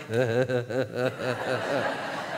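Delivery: low staccato chuckle; gloating put-on laugh; mock snickering. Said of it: "opponents are like".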